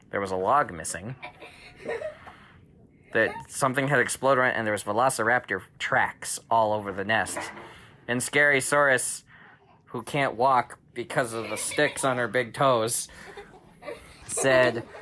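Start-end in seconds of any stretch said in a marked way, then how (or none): none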